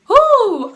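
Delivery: high loud whoop; excited whoo. Real, not acted. Reacting to a viewer's weight question, loud whoop. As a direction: let out a happy whoop.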